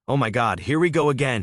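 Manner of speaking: mock teenage shout of here we go again; exasperated shout. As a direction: throw it up in exasperation, half-shouted. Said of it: "he shouted".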